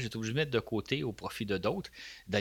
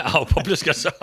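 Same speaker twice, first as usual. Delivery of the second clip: laughing; 6 semitones higher, 11 dB louder than usual. Real, not acted. Laughs at co-host's joke.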